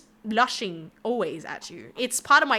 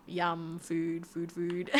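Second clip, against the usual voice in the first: mock food trance; 7 semitones lower, 11 dB quieter, much slower than usual. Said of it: "because I'm looking at the food".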